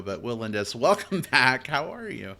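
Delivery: ordinary talk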